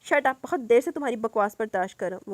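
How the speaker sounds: loud, angry rebuke; angry snap. Acted. Salar loses patience with Ruha, angrily loud. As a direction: cut her off sharply in anger. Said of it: "وہ غصے سے بولا".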